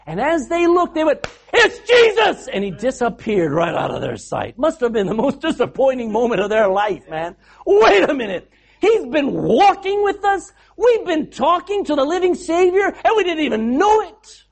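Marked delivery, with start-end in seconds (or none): 1.5-2.5 loud, high burst of recognition; astonished shout. Acted. Disciples recognizing their guest at dinner, astonished shout. 7.7-14.4 high, loud, mounting disbelief; amazed realization. Acted. Disciples realizing who walked with them, amazed disbelief.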